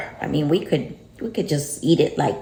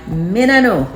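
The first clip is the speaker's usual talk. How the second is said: slightly raised Jamaican patois; light Jamaican patois; Jamaican accent; 2 semitones higher, 4 dB louder than usual. Pretend. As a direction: Jamaican patois again, chuckling. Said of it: "The Jamaican a come out".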